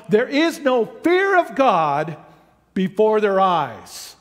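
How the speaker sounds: high, forceful pulpit reading; emphatic scripture reading. Real, not acted. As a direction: declaim the verses like an indictment. Reads scripture aloud emphatically.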